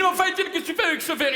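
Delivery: high, fast, laughing yell; retold shout. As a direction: scold him mid-scene, giggling. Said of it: "Je lui dis".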